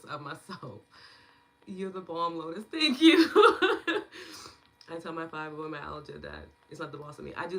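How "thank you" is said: high loud laughing thanks. Real, not acted.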